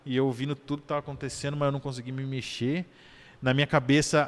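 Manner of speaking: usual speaking voice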